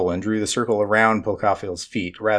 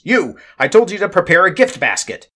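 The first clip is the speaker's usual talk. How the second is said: scolding yell; 9 semitones higher, 5 dB louder than usual. Acted. Nestmom scolds a lesser cousin.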